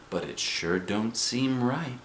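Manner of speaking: put-on slow, soft old woman; grandma. A narrator's reading. Grandma answers her husband, old woman. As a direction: drag it out softly, doubtful. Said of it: "said the grandma".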